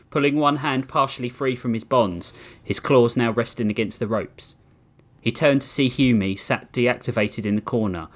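ordinary talk, nothing marked

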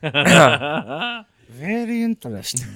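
put-on very slow drawn-out German villain accent; cool foreign spy menace, slow and drawn out. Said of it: "He goes German".

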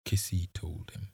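usual speaking voice

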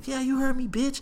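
high, cocky retort; retold defiant comeback. Friend answers bouncer in the argument, defiant.

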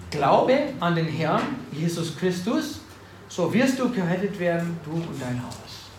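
langsam und eindringlich gepredigt; preaching scripture. Acted. Preacher reads scripture aloud.

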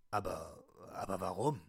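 low put-on voice; disguised deep voice. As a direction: drop low for the second character. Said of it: "meine Stimme zu verstellen".

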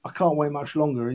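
ordinary talk, nothing marked

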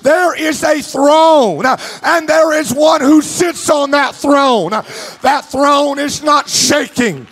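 preaching at full force. Acted. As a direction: lift the congregation, preach it out.

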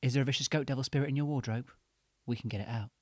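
cheesy ad read, loud and upbeat; advert pitch. Acted. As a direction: sell it like a late-night advert. Spiritual healers' advert pitch.

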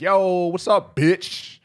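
loud, high buddy hail; rowdy greeting. Retold or imitated. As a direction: call out boisterously to a friend.

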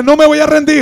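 high, loud rallying cry; fervent preaching. Acted. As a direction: preach it at full force to the crowd. Preaches at full force.

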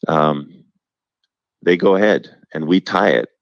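usual speaking voice

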